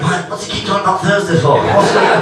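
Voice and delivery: put-on high, baffled drunk mate voice; puzzled mate. Confused mate quoted mid-story.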